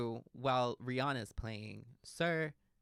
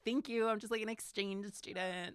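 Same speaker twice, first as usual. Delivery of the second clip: lightly put-on British accent, pitched up; 7 semitones higher than usual. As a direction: pose as a British exchange student, hint of accent. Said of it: "telling me how British I was".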